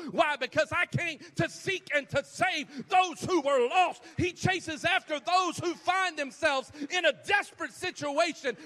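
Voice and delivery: loud, high, driving sermon climax; rousing preacher in full cry; impassioned preaching. Real, not acted. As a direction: build to full-force preaching, phrase upon phrase.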